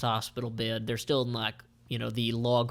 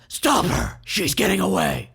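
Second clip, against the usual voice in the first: higher, louder shouted alarm; mock yell; 6 semitones higher, 6 dB louder than usual. Grosvenor yells at guards as Sai escapes, loud and high. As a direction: call the guards after her. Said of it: "And he yells".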